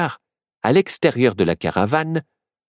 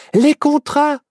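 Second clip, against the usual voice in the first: put-on high-pitched, urgent owl-girl voice; high, animated little character; excited cry; 14 semitones higher, 4 dB louder than usual. A narrator's reading. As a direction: rattle out the realization, high and eager. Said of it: "s'écria Myrtille".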